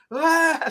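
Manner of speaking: high-pitched mock scream; short imitated cry of alarm; startled yelp. Reacting as the shadow suddenly moved, startled yelp.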